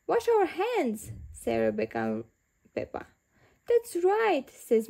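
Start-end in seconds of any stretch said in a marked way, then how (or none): 0.1-1.1 put-on child voice. Children answer together. 3.7-4.5 a hint of a kindly badger lady; light, friendly grown-up voice; lightly voiced Missus Badger.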